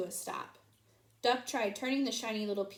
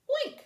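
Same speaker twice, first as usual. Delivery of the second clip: high oink; 3 dB louder than usual. A narrator's reading. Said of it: "said Pig and Pig".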